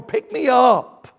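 put-on high, loud child's plea; slow, pleading kid; little kid. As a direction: beg slowly like a child to a parent. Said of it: "Like a little kid would to their parents".

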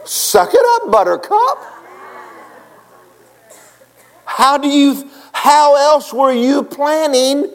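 high, loud, slow pulpit blast; fiery preaching. Acted. Preacher challenges the congregation, full force. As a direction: preach it hard at them.

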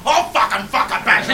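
loud, rough Australian yell; Aussie outburst. Retold or imitated. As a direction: let the Australian in you burst out. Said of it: "the Aussie in me kicked in going".

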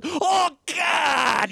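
high mock wail; dramatic cry. Imitating a woman in the film, dramatic cry. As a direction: cry out in hammed-up despair, way up high.

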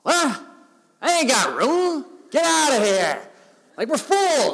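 loud, high, brash Danny DeVito voice; Danny DeVito impression. Innkeeper turning away the couple, as loud brash Danny DeVito. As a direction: bark it out like a grumpy little innkeeper. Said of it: "I can see Danny DeVito being the one going".

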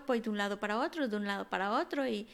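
fast, hurried run of words; restless rushing. Acted. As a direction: rattle it off like a bird that never stops. Crow describes restless rushing.

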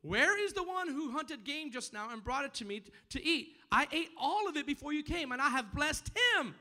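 high, loud cry of alarm; acted alarm, preached at full voice; trembling alarm. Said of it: "he started trembling exceedingly and said".

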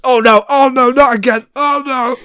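high, loud panicked cries; panicked dwarf. Acted. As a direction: yelp it in rising panic. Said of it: "And the dwarf says".

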